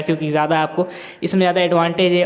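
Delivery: usual speaking voice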